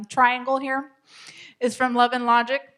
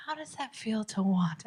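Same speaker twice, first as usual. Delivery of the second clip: softer, trying to sound caring; attempted empathy; 8 dB quieter than usual. Shown as a demonstration. Coach retries the line for parents, softly attempting empathy. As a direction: soften it into a tentative kindness. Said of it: "I don't know if I could say that empathetically".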